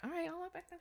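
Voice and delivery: small, high, soft voice; meek giving in. Retold or imitated. Guru facing growling Appa, meekly backing down. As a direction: back down in a tiny imitated voice.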